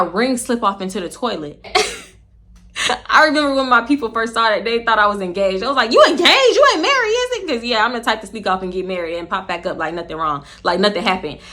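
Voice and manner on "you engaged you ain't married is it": rising higher with each word in mock shock; shocked relatives. Family spotting her ring, voice climbing in mock shock. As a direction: gasp it out like a stunned aunt.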